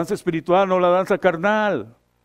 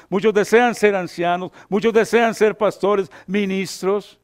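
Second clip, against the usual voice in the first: louder, higher preacher's exhortation; fervent preaching; 4 semitones higher, 5 dB louder than usual. Real, not acted. Pastor exhorting would-be ministers, fervent and loud. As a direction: drive each point home from the pulpit.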